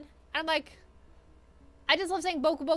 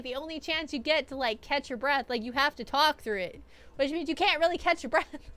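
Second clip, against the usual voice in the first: ordinary delivery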